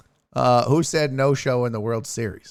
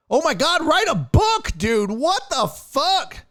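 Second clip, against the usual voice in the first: high, exasperated outburst; 14 semitones higher, 4 dB louder, slower than usual. Real, not acted. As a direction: cry out at the tweet in disbelief. Mocking long tweet.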